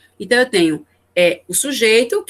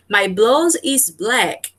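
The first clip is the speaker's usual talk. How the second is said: slow example read; much slower than usual. Shown as a demonstration. Teacher reads English example.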